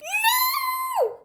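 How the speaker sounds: very high, drawn-out wail of refusal; shriek. A narrator's reading. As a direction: shriek it in dread. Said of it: "shrieked Henry".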